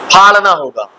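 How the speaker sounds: usual speaking voice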